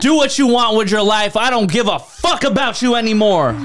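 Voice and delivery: high, exasperated parental outburst; high-pitched acted scolding; fed-up parent. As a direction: play a parent who has had enough. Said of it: "worst thing you can ever hear from your Filipino parents".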